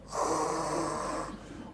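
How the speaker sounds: long soft sigh at the effort; weary sigh. Retold or imitated. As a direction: sigh it out, too tired to move. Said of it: "so you're like".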